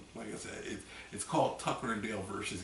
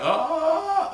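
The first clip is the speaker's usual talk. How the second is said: high, loud scream; mock terrified scream; 13 dB louder than usual. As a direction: scream like you're fleeing a chainsaw killer. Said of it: "running and screaming like".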